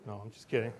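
normal talking voice

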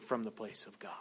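hushed, breathy whisper; sermon line whispered for weight. Acted. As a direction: drop to a whisper to draw them in. Sudden whisper.

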